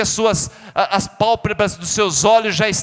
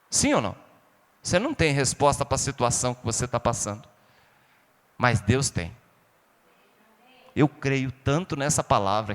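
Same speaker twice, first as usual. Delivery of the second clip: low, soft, tender pastoral voice; soft intimate preaching; 6 semitones lower, 5 dB quieter than usual. Acted. Preacher consoling grieving listeners, soft and low. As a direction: draw the congregation in gently, much lower and softer.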